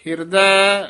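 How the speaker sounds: ਹਿਰਦੈ alone, then a clear pause; pause after word. Shown as a demonstration. Teacher showing where to rest: ਹਿਰਦੈ, then a pause. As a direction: let ਹਿਰਦੈ stand by itself before going on. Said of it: "ਇਹ ਵੀ ਹਿਰਦੈ ਪਦ ਤੇ ਵਿਸਰਾਮ ਹੈ".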